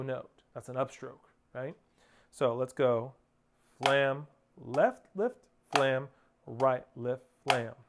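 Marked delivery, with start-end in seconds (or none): 3.8-7.7 slow, evenly spaced stroke calls; measured rhythmic drum count; nice and slow. Shown as a demonstration. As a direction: call each stroke on the beat, unhurried. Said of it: "Nice and slow".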